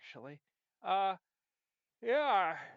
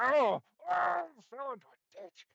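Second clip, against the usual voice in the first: mock fall cry; 9 semitones higher, 7 dB louder than usual. Character falling, exclaims.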